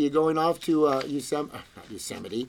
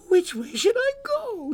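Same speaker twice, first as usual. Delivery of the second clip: put-on little girl wondering. A narrator's reading. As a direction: wonder aloud as a small lost girl. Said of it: "she wondered".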